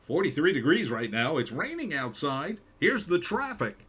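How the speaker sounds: mock weather forecaster, rising on the traffic; weatherman voice. Shown as a demonstration. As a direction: play the local weather guy with bounce. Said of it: "I know how to do the weather".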